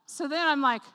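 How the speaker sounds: ordinary delivery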